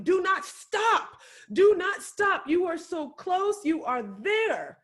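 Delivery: loud, high, slow exhortation; rally them, hammering each phrase; rousing shout. Real, not acted. Speaker rallying the audience: loud rousing exhortation.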